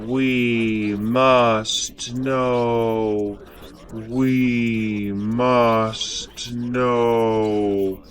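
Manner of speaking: distorted, sinking from low to very deep, slowing to a crawl, loud "No"s; otherworldly hive voice. Acted. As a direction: chant like a collective mind swallowing the speaker. Possessed, distorted chant takes over.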